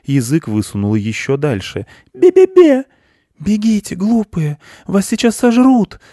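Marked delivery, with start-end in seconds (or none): 2.1-2.8 very high, very loud mouse jeer; obnoxious tiny mouse squeak; nastier taunting. A narrator's reading. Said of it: "скорчила рожу ещё противнее". 3.4-6.0 high, loud mouse yell of warning; desperate little mouse cry; screaming with all might. A narrator's reading. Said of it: "закричал изо всех сил мышь".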